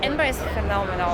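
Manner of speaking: ordinary delivery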